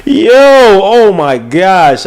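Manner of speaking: high, loud, drawn-out exclamation; stunned amazement. Real, not acted. Host reacting to a sixteen-year career, amazed. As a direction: stretch it out in real astonishment.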